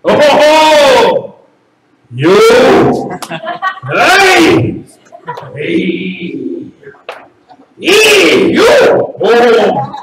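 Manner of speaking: string of loud exclamations leaping in pitch; hammed-up Italian outbursts; really feeling it. Shown as a demonstration. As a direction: build a whole argument from single cries. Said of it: "take a combination of letters".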